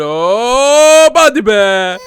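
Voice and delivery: straining shout. Acted. Throwing the baby.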